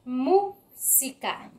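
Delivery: "Música" in slow, separated syllables. Shown as a demonstration. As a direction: sound out "Música" slowly for learners to find the strong syllable. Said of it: "la sílaba más fuerte".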